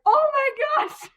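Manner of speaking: imitated surprise. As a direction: stretch it out in amazement. Nurses discover nine centimeters.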